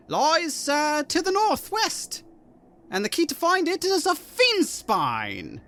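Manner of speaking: slow, drawn-out character voice climbing very high; gnome head giving directions. Acted. Gnomish head revealing where the portal lies, slow put-on voice. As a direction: stretch the words, rising into a high pitch.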